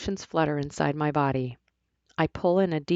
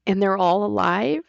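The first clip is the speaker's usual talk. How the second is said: tearful; 5 semitones higher, 5 dB louder than usual. A narrator's reading. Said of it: "a sob threatens to break free".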